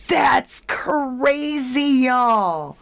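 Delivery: drawn-out, high, amazed "that's crazy"; stunned disbelief. Real, not acted. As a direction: let the shock stretch the words out slowly. Shocked by Simpsons prediction clip.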